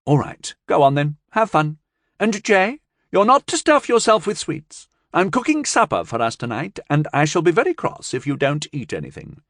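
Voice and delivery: a hint of a scolding grandmother; mildly stern old woman warning the children; lightly voiced stern old woman. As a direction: lay down the rules firmly. Tracy lectures children.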